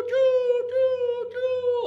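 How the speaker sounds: high, loud choo-choo; train chugging. Retold or imitated. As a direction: puff like a steam train. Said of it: "And then the train".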